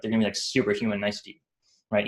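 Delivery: ordinary talk, nothing marked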